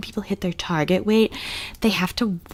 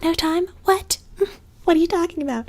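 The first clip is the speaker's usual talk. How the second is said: much higher incredulous squeal; mock outraged mother; 10 semitones higher than usual. Mocking the mother's disbelief, high squeal. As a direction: act baffled and offended. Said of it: "She's like".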